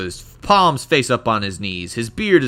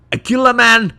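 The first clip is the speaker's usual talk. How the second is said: louder, raised dwarf in rough Scottish; wandering Scottish accent; 5 semitones higher, 9 dB louder than usual. Acted. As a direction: spread gossip in an unsteady Scottish brogue. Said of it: "This accent's gonna go all over the place".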